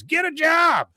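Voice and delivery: high yelled jeer; mock angry bystander's shout; heckling shout. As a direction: yell it up high at the protesters. Bystander heckles the protest kids.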